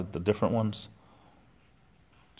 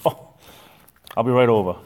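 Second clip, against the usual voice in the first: louder, keen jokey promise; mock eager; 3 semitones higher, 9 dB louder than usual. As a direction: pretend to rush over for cookies. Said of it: "No, I'm only joking".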